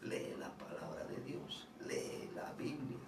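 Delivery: whispering to the brother to read the Bible; whispered urging. Retold or imitated. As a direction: hush it like a secret. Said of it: "le dije a él".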